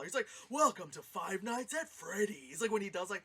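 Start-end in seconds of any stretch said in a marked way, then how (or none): 0.5-2.6 exaggerated, slow YouTuber announcer imitation; Markiplier. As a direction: mimic a hammy game streamer's welcome.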